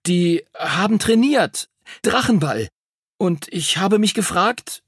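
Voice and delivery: put-on halting high boy's voice; tentative pupil edging towards a request; stammering. A narrator's reading. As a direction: break it into hesitant pieces. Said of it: "stammelte er".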